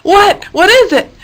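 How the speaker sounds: high, loud startled question; startled awake. A narrator's reading. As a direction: jolt awake and demand.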